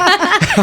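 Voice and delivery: high burst of laughter. Real, not acted. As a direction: crack up laughing. Laughing at accent demo.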